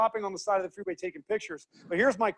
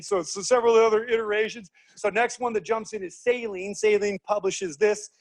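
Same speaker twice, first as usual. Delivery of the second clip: ordinary talk, nothing marked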